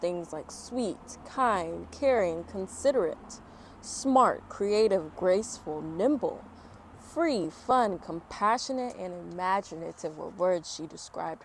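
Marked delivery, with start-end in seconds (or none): none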